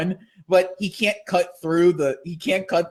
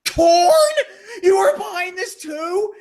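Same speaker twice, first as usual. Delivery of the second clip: high, raised accusing questions; mock shocked teen; betrayed surprise; 13 semitones higher than usual. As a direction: accuse your own family. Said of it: "it's like".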